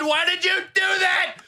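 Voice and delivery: mock-furious screech at the viewer; mock screaming. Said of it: "That hurt my throat to do".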